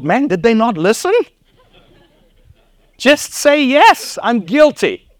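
high, incredulous coaching; high, mocking disbelief; exasperated thought.

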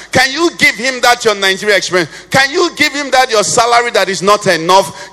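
preaching at full force. Acted. Preacher pleading with the congregation, full force. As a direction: preach it out to the whole church. Altar appeal, building.

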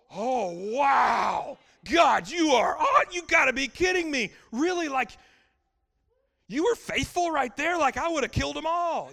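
high, shouted amazement at God; awestruck shout. Retold or imitated. Preacher voices his own stunned reaction to God, shouting. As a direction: shout it like you can't believe His faithfulness. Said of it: "and go".

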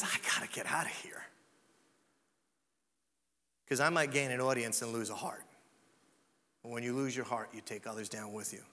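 hushed inner voice. Acted. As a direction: confide it quietly. Voicing Jesus' inner thought.

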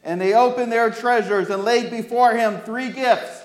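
put-on clear, lively storytelling for children; story reading. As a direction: read the Christmas story to the kids. Reads Bible story to children.

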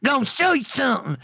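put-on high childish kid voice; little kid voice. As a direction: call out like an excited little boy. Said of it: "he's like".

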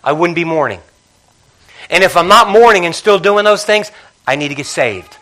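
loud, high, ringing pulpit voice; shouting the point home from the pulpit; full-force preaching. Acted. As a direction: preach it out to the whole church.

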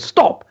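"Stop" punched high and sharp; exclamation mark on "Stop"; emphasis. Shown as a demonstration. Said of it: "So the emphasis is on".

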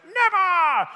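put-on high, loud kid yell of refusal; child's defiant shout. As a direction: scream it like a stubborn little boy. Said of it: "I just hear Caius shouting".

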